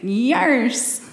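drawn-out pirate "yes"; mock pirate. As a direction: playfully answer like a pirate. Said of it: "pirate pub crawl with me".

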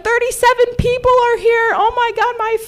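hammed-up weepy squeal, very high; mock-crying with overjoyed relief; tearful outburst. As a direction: wail it in a very high put-on voice. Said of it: "I bursted into tears crying".

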